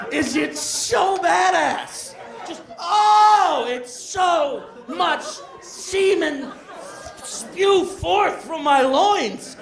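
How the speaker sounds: high, very slow, stretched-out ecstasy; hammed-up rapture. Comic relives frightened climax for the crowd, hammed-up slow rapture. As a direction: savour every word in mock-epic bliss.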